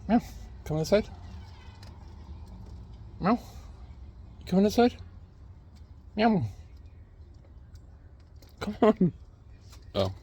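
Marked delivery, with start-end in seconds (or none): 0.1-9.1 calling the cat. Real, not acted.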